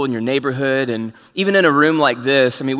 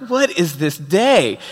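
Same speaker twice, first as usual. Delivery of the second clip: exasperated disbelief; 5 semitones higher, much slower than usual. Retold or imitated. Preacher retells his own disbelief.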